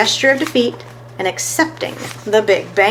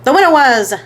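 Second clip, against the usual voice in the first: loud, higher call to the room; 6 semitones higher, 6 dB louder than usual. A narrator's reading. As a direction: shout the news. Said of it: "called Downtown Fats".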